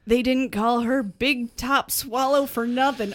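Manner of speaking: ordinary delivery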